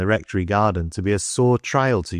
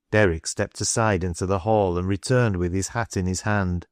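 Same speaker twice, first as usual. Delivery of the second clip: usual speaking voice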